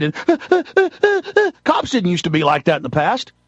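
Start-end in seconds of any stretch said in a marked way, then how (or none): 0.2-1.6 quick, high-pitched fearful gasps; high, rapid panicky huffing in mockery; mock frightened panting. Mocking cops taught to be afraid, frightened high gasps. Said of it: "teach the cops to be afraid".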